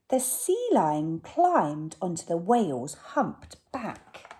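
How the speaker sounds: usual speaking voice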